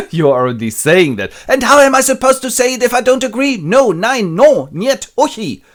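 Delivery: high, loud frustrated no; exasperated refusal. Retold or imitated. Lizzie refusing Collins, high and loud. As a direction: shout no in every language. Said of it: "I do not agree".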